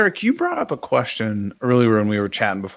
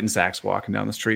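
ordinary talk

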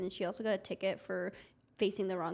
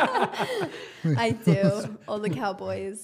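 laughing; 13 dB louder, much slower than usual. Real, not acted. Proud reply laughing.

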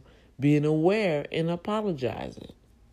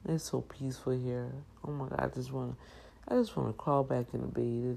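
ordinary delivery

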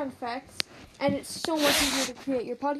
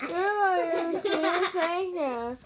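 slow, higher, silly mocking voice; mock dumb voice; 5 semitones higher, much slower than usual. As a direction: drag it out like a dopey copycat. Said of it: "You sounded so stupid".

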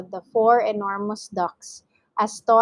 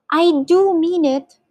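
high, loud insistence; urgent, pleading assurance; earnest plea; 9 semitones higher, 5 dB louder than usual. A narrator's reading. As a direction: insist desperately that you mean it. Mister Gregg begs to be believed.

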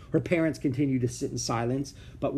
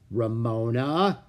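warning; 4 dB louder, slower than usual. Acted. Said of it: "Mister Quimby's voice held a warning".

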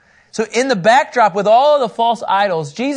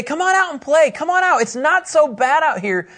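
put-on higher, sing-song kid's call to come outside; kids calling out; 7 semitones higher than usual. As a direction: coax like a child at the door. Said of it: "We need the kids to say".

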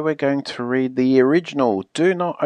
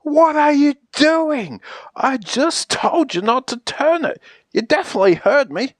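high, indignant telling-off; acted outrage, pitched up; exasperated scolding; 7 semitones higher than usual. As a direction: protest that you were clearly ignored. Scolding after page turned again.

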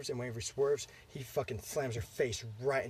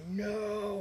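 high, drawn-out imitated "no"; mock horrified cry; 9 semitones higher than usual. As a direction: act out a father calling out in horror. Dad cries out as son runs.

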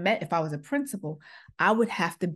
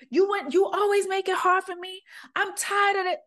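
high, strained mock sob-yell; imitating a crying yell; 13 semitones higher, 4 dB louder than usual. Daughter breaking down at her mother over duvets, high tearful yell. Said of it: "you broke... You were crying, you were yelling".

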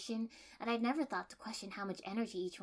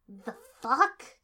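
loud, higher, incredulous; disbelieving; 4 semitones higher, 14 dB louder than usual. A narrator's reading. Kiba reacting to the heroine's plan, loud disbelief. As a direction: spit it out in disbelief. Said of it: "Kiba said disbelievingly".

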